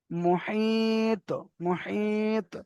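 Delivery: মুহিত with a long level i and released t; drawn-out madd. Shown as a demonstration. Teacher demonstrates the word, মুহিত with long madd and echo. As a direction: stretch the i of মুহিত slowly, softly, then bounce the t. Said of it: "এখানে টান কেন হচ্ছে?".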